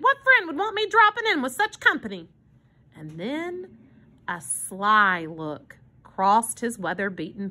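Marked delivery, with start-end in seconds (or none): 0.0-2.3 high, quick indignant question; demanding. A narrator's reading. Armand objecting to such company, indignant demand. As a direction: rattle off the protest, pitched up. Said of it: "he demanded".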